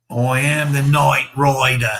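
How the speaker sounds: slow, proclaiming mock Australian accent; hammed-up Australian, vowels stretched wide; terrible Australian accent. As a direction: announce it grandly in a bad Aussie accent. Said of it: "Australian accent".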